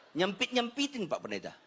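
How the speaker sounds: normal talking voice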